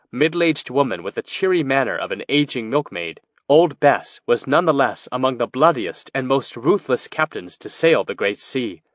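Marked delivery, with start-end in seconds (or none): none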